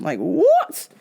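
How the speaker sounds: ordinary delivery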